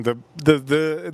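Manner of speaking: ordinary talk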